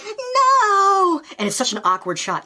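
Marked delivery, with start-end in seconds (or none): 0.0-1.2 high, loud cry of "No!"; retold shout. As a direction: scream it out to stop him. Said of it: "and she's like".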